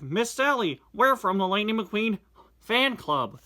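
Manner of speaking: excited fan club member; eager fan voice. Acted.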